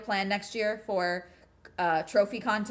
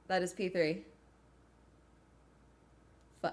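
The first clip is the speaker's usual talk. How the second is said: usual speaking voice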